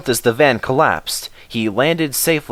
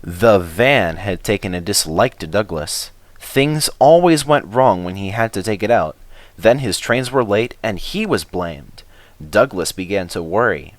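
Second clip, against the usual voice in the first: usual speaking voice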